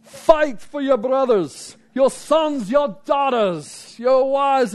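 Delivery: high, slow, hammed-up Scottish rallying cry; bad Scottish accent, Braveheart battle speech. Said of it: "thinking of a Scottish accent".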